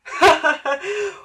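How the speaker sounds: very high, loud giggle; children's laughter. A narrator's reading. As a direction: laugh at someone like mocking children. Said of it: "The children laughed again".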